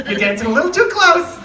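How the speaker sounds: hammed-up teacher's aide warning kids apart. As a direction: wag a finger as the prim teacher's aide. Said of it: "she's like".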